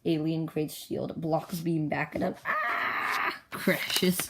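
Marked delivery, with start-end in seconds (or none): 2.4-3.3 very high acted "ah" scream; squealed crash scream; high-pitched scream. Pilot hit by his own beam, high scream.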